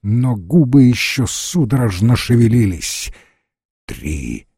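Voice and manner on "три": very low, slow menacing voice; deep and slow, like a verdict; sinister stranger. A narrator's reading. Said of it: "отвечал тот".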